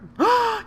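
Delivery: a shocked high gasp at the shot; sharp high gasp. Real, not acted. Golfer gasping as his ball goes wrong, sharp high gasp.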